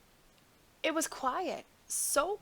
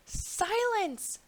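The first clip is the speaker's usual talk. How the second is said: sharp, much higher bark of a king; thundering king; 10 semitones higher than usual. A narrator's reading. King silencing the cawing crows with a thundering shout. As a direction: silence the crows with one blast. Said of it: "thundered the king".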